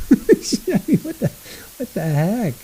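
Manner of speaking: laughing in disbelief. Real, not acted. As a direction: laugh through the words. Laughing at trial testimony.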